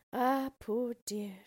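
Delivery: slow, drawn-out pity; pitying princess. A narrator's reading. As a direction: sigh it with mock sympathy. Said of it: "she said".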